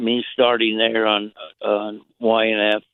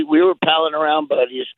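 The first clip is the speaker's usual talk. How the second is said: normal talking voice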